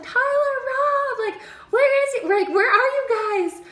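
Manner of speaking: high, loud calling for friends. Retold or imitated. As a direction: shout their names across the woods. Lost, calling for family.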